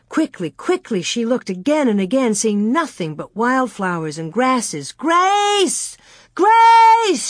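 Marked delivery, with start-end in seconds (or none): 4.9-7.3 very high, drawn-out scream. A narrator's reading. Laura seeing only wildflowers and grass, screaming for Grace. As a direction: scream the name across open ground. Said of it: "she screamed".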